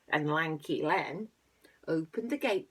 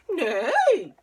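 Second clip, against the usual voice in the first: high, loud drawn-out whinny; horse neigh; 10 semitones higher, 12 dB louder than usual. A narrator's reading. Reader voices the horse for children, loud high neigh. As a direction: neigh loudly like a storybook horse. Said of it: "said the horse".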